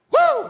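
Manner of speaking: a high, loud whoop of joy; joyful whoop. Real, not acted. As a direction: let out a happy preacher's shout. Preacher whoops after Amen.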